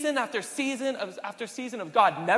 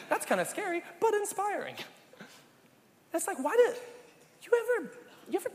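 high, laughing reaction; 11 semitones higher, faster than usual. Real, not acted. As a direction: laugh through the words. Laughing at the old lady's remark.

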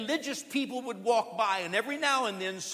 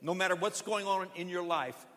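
usual speaking voice

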